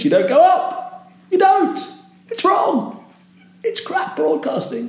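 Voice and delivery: "up", "don't", "wrong" and "broadcasting" pushed sky-high; exaggerated wrong uptalk. Shown as a demonstration. Broadcaster mocking bad intonation, very high mock uptalk on "up" and "wrong". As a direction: send each short sentence right up at the end, ending high on "up", "don't", "wrong", "broadcasting". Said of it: "You go down at the end of the sentence".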